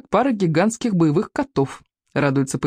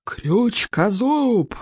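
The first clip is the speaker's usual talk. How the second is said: high, long-drawn call of the name; slow, rising-then-falling acted bellow; roaring; 7 semitones higher, much slower than usual. A narrator's reading. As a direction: stretch the name out as a scolding roar. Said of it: "взревел Стоик".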